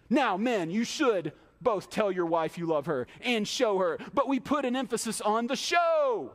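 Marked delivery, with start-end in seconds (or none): none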